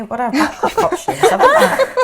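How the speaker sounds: joking through a laugh. Real, not acted. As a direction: laugh it out cheekily. Jokes about a married man.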